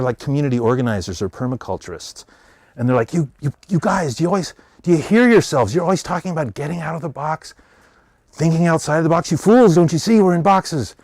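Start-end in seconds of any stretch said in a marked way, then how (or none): 3.1-11.0 mock-lecture the others on being stuck in boxes; exasperated agitator. Agitator pawns scolding other pawns.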